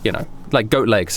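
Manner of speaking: usual speaking voice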